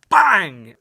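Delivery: explosive storytelling sound effect; sudden bang. Retold or imitated. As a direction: punch it out like a sudden gunshot. Acts out the sudden gunshot.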